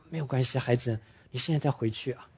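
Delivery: soft, soothing mother's voice; gentle mother. Retold or imitated. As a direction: comfort the hurt child gently. Mother comforting her child. (妈妈温柔地安慰孩子)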